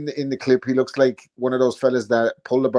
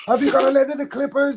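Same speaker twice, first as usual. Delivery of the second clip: mock a commentator's excited call, pitched way up; imitated commentator; 13 semitones higher than usual. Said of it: "I kept on hearing it in, in the commentary".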